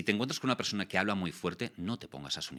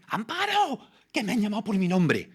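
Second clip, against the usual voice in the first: much higher, loud, thrilled shout; shrieking with delight across the café; excited shout; 9 dB louder than usual. Retold or imitated. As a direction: call out to a coworker, overjoyed. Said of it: "El grito que pegó".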